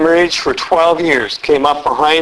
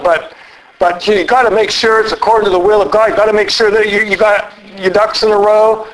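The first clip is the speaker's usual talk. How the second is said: high-pitched, hedging mock warning; mock cautioning; 5 semitones higher than usual. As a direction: caution them with fussy, hammed-up reservations. Acting an imagined discouraging Jesus.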